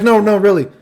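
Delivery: high, loud mock protest; imitated insistence. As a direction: insist like an overdramatic girl. Girl insists to him.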